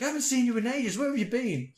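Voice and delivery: gush, forgetting you just met; drunk friend. Retold or imitated. Drunk friend repeats greeting.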